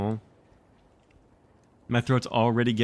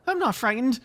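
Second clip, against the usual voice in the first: put-on defiant boy. Michael argues back.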